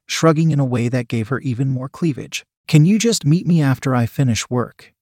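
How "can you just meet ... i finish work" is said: ordinary delivery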